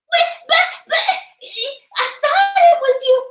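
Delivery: hammed-up pleading girlfriend; hysterical woman.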